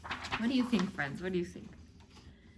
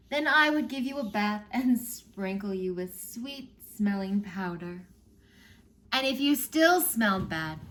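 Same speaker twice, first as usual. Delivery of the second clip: ordinary delivery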